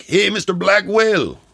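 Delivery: put-on high, slow Mexican-accented call; Mexican impression. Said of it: "this is an impersonation of him".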